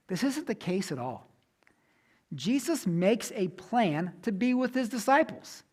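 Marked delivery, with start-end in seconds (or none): none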